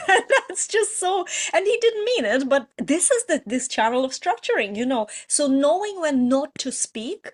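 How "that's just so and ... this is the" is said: laughing through the words, voice lifted; a fond, rueful laugh as she speaks; light laughing aside. Real, not acted. Laughing about late husband.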